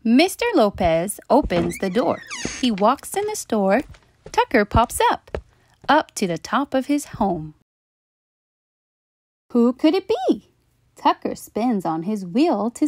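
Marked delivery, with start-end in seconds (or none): none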